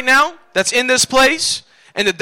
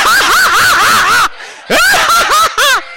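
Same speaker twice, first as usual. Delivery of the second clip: very high, shrieking laugh; faked hearty laugh; 3 dB louder, much slower than usual. Shown as a demonstration. Preacher demonstrating faked laughter, wild high laugh. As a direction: force out a wild, high cackle. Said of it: "you gotta fake it to get over".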